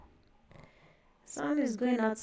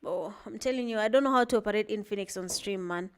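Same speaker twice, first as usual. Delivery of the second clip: ordinary talk, nothing marked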